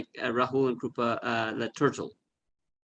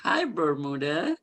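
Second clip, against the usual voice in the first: talking to a pet; 5 semitones higher, slower than usual. Real, not acted. Greeting pet turtle.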